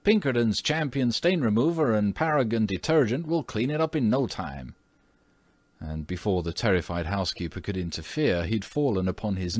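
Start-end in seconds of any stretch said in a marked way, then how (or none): 0.0-4.7 put-on confident boy. Son boasts like an advert.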